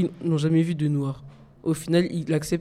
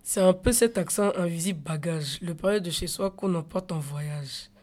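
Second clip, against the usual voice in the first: soft poem recitation; 2 semitones higher, 6 dB quieter than usual. Acted. Student recites poem softly.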